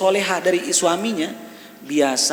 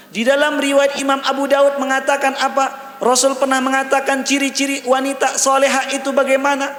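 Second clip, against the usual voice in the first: high-pitched sermon delivery; raised, driving preacher's voice; impassioned preaching; 10 semitones higher, 5 dB louder than usual. Real, not acted. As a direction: preach it high and insistent to the congregation. Preaching at full force.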